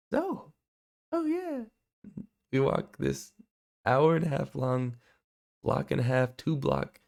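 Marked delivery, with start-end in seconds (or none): 0.1-1.6 put-on much higher old lady's voice, casual agreement; elderly woman replying. Elderly woman answers him.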